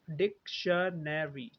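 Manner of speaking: डिक्शनरी slowly as dik-shuh-ne-ree; four syllables. Shown as a demonstration. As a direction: break डिक्शनरी into four slow syllables, schwa kept. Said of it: "चार syllable है". (डिक्शनरी चार syllable में, धीरे-धीरे: डिक-श-ने-री)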